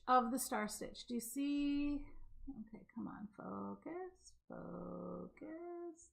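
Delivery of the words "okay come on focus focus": soft under-breath coaxing; muttering to camera. Real, not acted. Urging her camera to focus, softly muttered.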